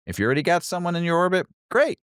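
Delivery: fast, high and bright encouragement; rising to an excited squeal on great. Acted.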